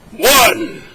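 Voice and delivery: booming shout of a single number; screaming. Acted. God booming the first number at the scribe, a loud shout. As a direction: bellow it like a god used to being feared. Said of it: "You don't have to scream".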